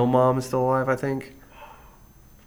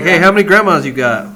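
nosy questioner; 7 semitones higher, 10 dB louder than usual. Retold or imitated.

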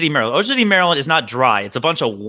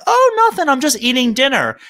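sing-song reply; 6 semitones higher than usual. Acted. Acting own phone reply to grandmother.

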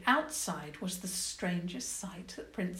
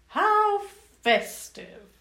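very high, loud, slow sarcastic cheer; mock festive; 14 semitones higher, 10 dB louder, much slower than usual. Goblin queen gloats sarcastically, falsely cheerful.

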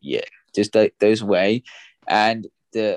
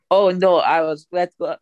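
higher, louder mock Russian accent; Russian accent, Red Guardian; 6 semitones higher, 3 dB louder than usual. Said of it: "Go with your Russian accent there".

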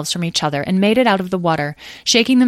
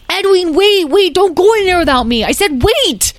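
put-on high, loud, insistent child; frantic yell; 13 semitones higher, 7 dB louder than usual. Lyle yells at Edwin not to go without him, frantic. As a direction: scream to be waited for. Child shouts after brother.

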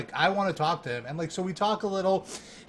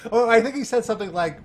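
normal talking voice

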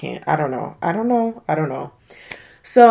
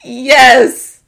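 high, loud laughing yes; laughing shout; 7 dB louder, much slower than usual. Real, not acted. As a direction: shriek it through laughter. Confirming the absurd paint roller.

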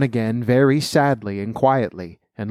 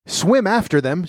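higher-pitched, restrained storybook scream; mild scream; 6 semitones higher than usual. A narrator's reading. Gryphon urging on the dancers, mildly screamed. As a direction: cry it out without much volume. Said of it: "screamed the Gryphon".

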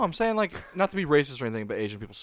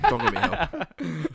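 slow, strange put-on delivery; odd put-on voice; 3 semitones higher, much slower than usual. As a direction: drag the line out in a silly voice. Odd quote, then redone laughing.

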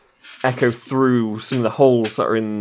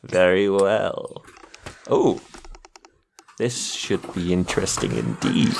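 villain voice; 4 semitones lower than usual. Acted. GM voicing the archmage.

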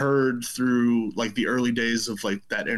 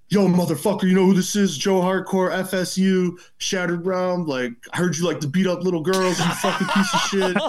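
loud, higher-pitched threatening caller; Joe Hardcore; 6 semitones higher, 3 dB louder than usual. Retold or imitated. Joe Hardcore rings a teenager to confront him, loud and threatening. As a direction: bark threats down the phone, mock-aggressive. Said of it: "it's just Joe Hardcore, and he's just like".

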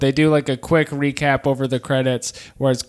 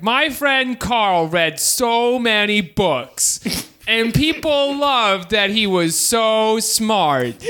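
slow, higher mock eulogy voice; 5 semitones higher, much slower than usual. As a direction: drag out a cheesy eulogy. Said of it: "Engels does a speech that's like".

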